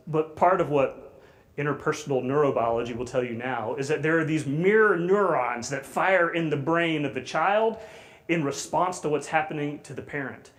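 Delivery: ordinary talk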